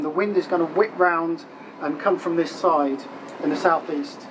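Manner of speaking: ordinary delivery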